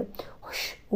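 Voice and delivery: breathy unvoiced hush; mother hushing her son in a whisper. A narrator's reading. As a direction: silence him in a whisper. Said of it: "తల్లి రహస్యంగా అంది".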